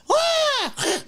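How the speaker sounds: sudden high far-off yelp of fright; high-pitched cartoon scream; mock startled scream. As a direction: shriek like a startled creature. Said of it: "they'll go".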